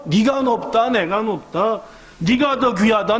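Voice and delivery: ordinary talk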